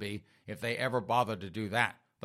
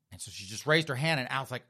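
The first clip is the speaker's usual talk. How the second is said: usual speaking voice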